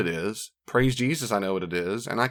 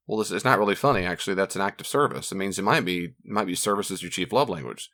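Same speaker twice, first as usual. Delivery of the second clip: ordinary delivery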